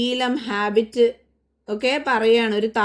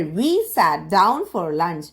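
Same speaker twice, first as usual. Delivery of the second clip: slow model reading; 2 semitones lower, much slower than usual. Shown as a demonstration. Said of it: "teacher models English sentence".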